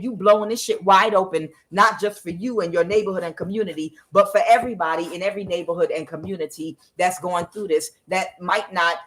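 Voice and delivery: usual speaking voice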